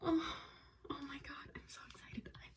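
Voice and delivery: breathy, whispered, tearful; overwhelmed, near tears, barely voiced; crying with joy. Real, not acted. Reading two lines to viewers, tearful whisper.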